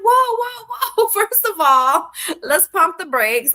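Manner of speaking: high, loud whoa, laughing it off; mock alarm. Mother reining in her crying son, high and loud. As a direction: hit the brakes on his worry, amused. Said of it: "I'm like".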